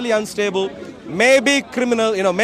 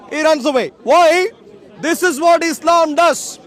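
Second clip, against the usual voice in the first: raised, higher preaching voice to the crowd; street preaching; 5 semitones higher, 4 dB louder than usual. Acted. Street preacher addressing the Speaker's Corner crowd, full-voiced preaching. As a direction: proclaim it like a soapbox preacher.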